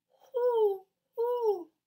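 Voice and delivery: high, loud owl call; owl hoot. Shown as a demonstration. Teacher modelling the owl hoot for kids. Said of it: "like the owl".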